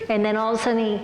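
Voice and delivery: usual speaking voice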